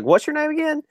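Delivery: high-pitched coach impression; Spurrier. Imitating the coach talking to a visiting recruit, high-pitched. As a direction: jump up high to mimic the coach. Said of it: "he talks in that really high-pitched voice".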